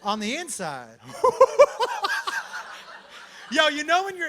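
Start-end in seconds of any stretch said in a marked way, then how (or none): 1.1-3.5 high cackling laugh. Real, not acted. Laugh burst.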